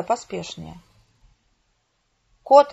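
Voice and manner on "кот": sharp, high, loud cry; exclaiming. A narrator's reading. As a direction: call out suddenly, much louder and higher. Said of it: "воскликнул Твит".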